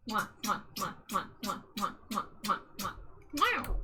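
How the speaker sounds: a run of smacking air kisses; kiss sounds. Acted. Streamer blowing smooches to her wife in chat, smacking kisses. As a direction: blow quick kisses one after another. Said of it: "another wife smooch".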